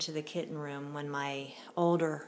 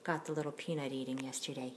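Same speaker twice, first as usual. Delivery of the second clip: ordinary talk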